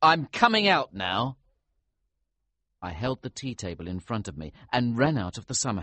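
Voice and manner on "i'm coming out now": loud called reply; calling out. A narrator's reading. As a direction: shout it through the door. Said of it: "I called".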